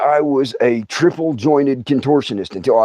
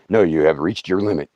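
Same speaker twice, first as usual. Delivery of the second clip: lower, flat machine reply; 10 semitones lower, 4 dB quieter than usual. Retold or imitated. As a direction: read it like a recorded refusal. Machine refusing more painkiller.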